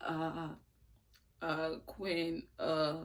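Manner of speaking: soft, sheepish stammer; caught boyfriend stammering. Retold or imitated. Boyfriend caught by Quinn, quietly stammering her name. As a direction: mumble like you've just been caught. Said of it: "he was just like".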